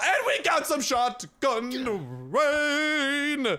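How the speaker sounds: high, drawn-out celebratory call; playful triumphant holler; hyped-up cheer. Pretend. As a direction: stretch it out like a big announcement. Celebrating the shotgun rain loot.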